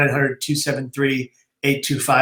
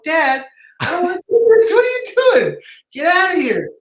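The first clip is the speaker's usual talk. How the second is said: put-on much higher, whiny panicked kid with a cry in it; panicked protest; 13 semitones higher than usual. Teen begs dad to back out of the class talk, panicked and high. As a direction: squeal it like a cornered teenager begging his dad.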